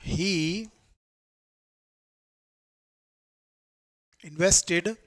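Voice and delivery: one word at a time with long pauses; slow dictation. Shown as a demonstration. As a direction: read "he invested lakh of dollars to treat his mother" slowly, word by word. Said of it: "जैसे". (रुक-रुककर एक-एक शब्द)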